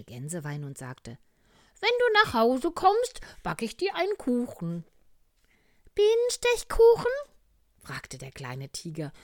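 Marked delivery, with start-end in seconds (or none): 1.8-4.8 very high, loud goose aunt. Acted. Aunt goose promises cake. 5.9-7.1 extremely high, loud, eager squeal; little tiger asking. Acted.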